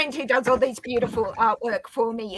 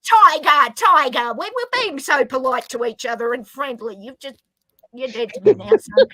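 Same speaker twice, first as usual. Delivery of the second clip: mock outrage; 2 semitones higher, 7 dB louder than usual. Mock outrage at a friend's joke.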